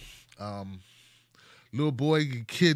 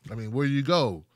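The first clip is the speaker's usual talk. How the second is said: ordinary talk, nothing marked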